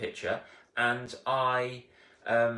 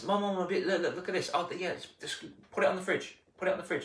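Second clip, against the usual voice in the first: higher, hurried; lightly voiced child and mum; eager child then brushed-off mum; 6 semitones higher, faster than usual. Child shows picture, mum brushes it off, higher voice.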